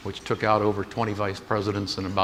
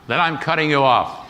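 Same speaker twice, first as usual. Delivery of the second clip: cutting off; 5 semitones higher, 5 dB louder than usual. Retold or imitated. Creditor threatening to cut off.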